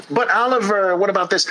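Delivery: high, pleading whine; mock protest, high and nasal; whiny excuse. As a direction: whine like a stalling child. Whiny objector.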